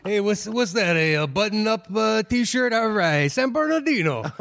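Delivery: high, hammed-up comic doing crowd work; a bad stand-up working the crowd; hammed-up comedian character. His made-up comedian character doing crowd work, hammed up. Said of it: "really shit crowd work".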